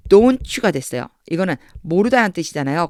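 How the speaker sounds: usual speaking voice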